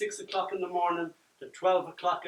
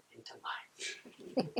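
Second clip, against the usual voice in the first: whisper; 10 dB quieter, much slower than usual. Real, not acted. Playful whispered title end.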